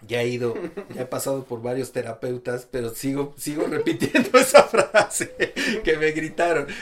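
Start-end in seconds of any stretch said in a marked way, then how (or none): 3.4-6.7 se ríe a media frase; riendo. Real, not acted. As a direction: suelta la risa mientras habla. Risa nerviosa al recordarlo. (riéndose nervioso)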